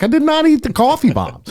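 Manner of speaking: high, indignant protest; indignant denial. Retold or imitated. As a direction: squeak out your innocence. Retold denial to accusing wife.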